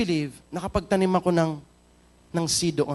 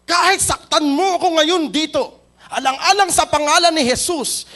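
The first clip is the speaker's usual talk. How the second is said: loud pleading; 9 semitones higher, 7 dB louder than usual. Acted. Preacher dramatizes offering to be struck.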